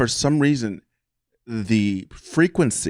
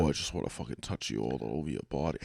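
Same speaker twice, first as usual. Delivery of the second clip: low, soft Boston guy come-on; lightly imitated Boston accent; Southie accent; 7 semitones lower, 11 dB quieter than usual. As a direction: imitate a creepy Southie hookup, lower and quieter. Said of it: "that Southie accent".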